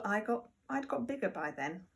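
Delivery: usual speaking voice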